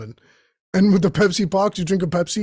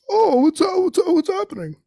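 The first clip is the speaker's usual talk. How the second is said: clueless bystander; 13 semitones higher than usual. Retold or imitated. An oblivious bystander in the movie scene, high chipper greeting. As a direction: play the oblivious extra at the phone booth. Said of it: "like, at the phone booth".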